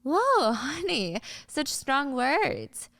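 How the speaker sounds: slow, high mocking coo; condescending. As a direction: drag it out to belittle her, very slowly. [name] condescends to angry Ari.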